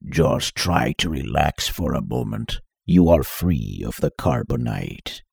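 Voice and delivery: low, slowed robotic masked voice; electronically distorted voice. Acted. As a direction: speak through a voice distorter. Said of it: "said an electronically distorted voice".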